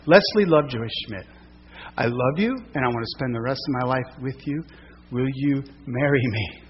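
soft, tender proposal; lightly voiced heartfelt proposal; proposing marriage. Proposing on one knee by creek.

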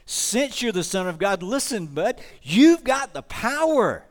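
high, loud, slow taunting devil voice; Satan taunting. Acted. Satan goading hungry Jesus in the desert, loud high taunt. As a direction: goad him like a smug tempter.

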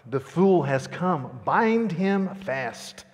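slow, loud commanding cry. A narrator's reading. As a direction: call out the order, drawn out.

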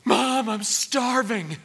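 high, drawn-out dramatic yell; teenager's yell. Acted. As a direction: whine it loudly like a starving teen. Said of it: "run up to you and yell".

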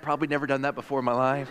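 normal talking voice

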